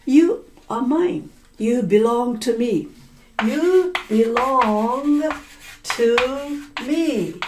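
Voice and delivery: each word spaced and leaned on; You belong to me, slow and stressed; slow emphatic claim. Acted. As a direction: drag it out, claiming ownership.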